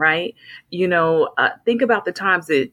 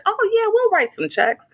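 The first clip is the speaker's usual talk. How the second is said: breezy rich parent. Retold or imitated. Parents' friends offering money.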